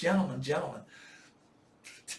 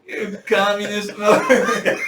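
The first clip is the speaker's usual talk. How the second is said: much louder, slow and laughing; laughing mock insult; 6 semitones higher, 16 dB louder, slower than usual. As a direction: bellow it again while cracking up.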